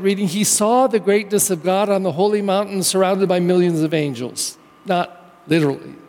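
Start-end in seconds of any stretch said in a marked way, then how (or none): none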